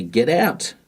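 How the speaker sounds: sharp urgent command to get out; urgent warning. Shown as a demonstration. Warning someone urgently to get out, urgent command. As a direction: warn them to leave now. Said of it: "warn someone with urgency".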